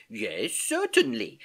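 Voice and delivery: high, slow, emphatic assurance; insistent. A narrator's reading. Uncle assuring his modest niece, insistent. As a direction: insist on it, drawing it out. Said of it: "insisted Uncle Albert".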